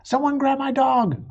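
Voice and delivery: shouted plea for help; calling out. A narrator's reading. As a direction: yell after the runaway dog. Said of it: "Another dog had broken away".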